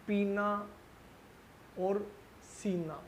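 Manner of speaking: पीना and सीना with the ई drawn out long; teacher demonstrating the long ई vowel; stretched long vowel. Shown as a demonstration. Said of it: "ये मैंने खींचकर बोला".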